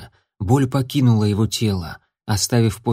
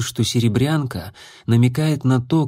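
usual speaking voice

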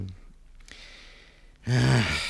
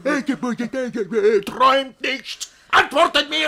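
very high, loud mocking stammer then order; mock their stammering, then yell; impatient yelling; 8 dB louder than usual. Master mimicking the servants' stammer, then shouting.

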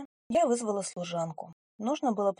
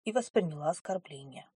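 ordinary delivery, nothing marked

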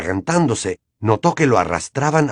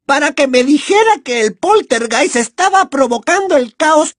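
gloating villain; 5 dB louder than usual. Acted. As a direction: savor the victory primly. Umbridge accuses.

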